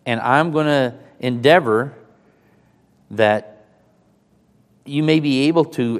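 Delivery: loud, projected Bible reading; scripture reading. Real, not acted. Preacher reads Peter's letter to the congregation, loud scripture reading. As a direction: read the verses out strongly to the room.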